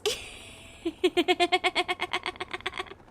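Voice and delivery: long high laugh rolling on; long real laugh. Real, not acted. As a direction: crack up and keep laughing. Laughs at own link joke.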